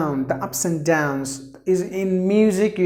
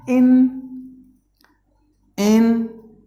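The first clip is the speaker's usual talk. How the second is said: "intonation" in four raised, widely spaced syllables; 5 semitones higher, 4 dB louder than usual. Shown as a demonstration. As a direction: sound out in-to-na-tion with long pauses. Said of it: "There are four syllables".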